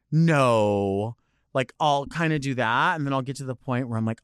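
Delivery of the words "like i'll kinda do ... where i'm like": ordinary talk, nothing marked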